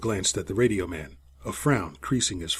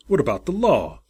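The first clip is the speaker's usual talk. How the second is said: growled; 6 semitones higher, 4 dB louder than usual. A narrator's reading. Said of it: "growled Sabo".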